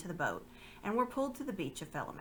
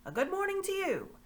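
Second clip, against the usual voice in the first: high, far-off ruffian's hail; hearty shouted greeting; 13 semitones higher, 4 dB louder than usual. Acted. A big stranger greets the travellers, with a hearty shout. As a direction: call out across the valley. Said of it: "shouted out".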